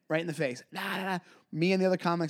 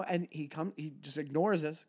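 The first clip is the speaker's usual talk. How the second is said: usual speaking voice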